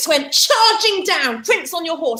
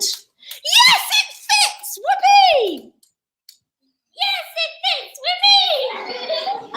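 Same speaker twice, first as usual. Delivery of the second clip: very high, laughing yes it fits; screaming with glee; slower than usual. Acted. Ugly sister screams with joy that the shoe fits. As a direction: shriek with delight, whooping. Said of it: "But she screamed".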